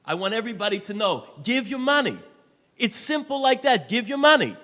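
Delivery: loud, high urgent cry to give; fervent loud plea; shouted appeal. Real, not acted. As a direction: shout the call for money. Fundraising appeal peaks.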